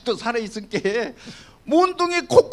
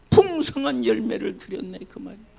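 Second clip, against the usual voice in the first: ordinary delivery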